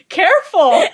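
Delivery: high, loud, laughing "careful"; mock alarmed shout. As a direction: yell a warning through a laugh. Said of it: "it just cuts to Jeremy going".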